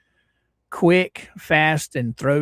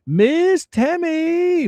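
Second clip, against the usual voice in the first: sing-song call; 13 semitones higher, 5 dB louder, much slower than usual. Real, not acted. Calling out a viewer's name.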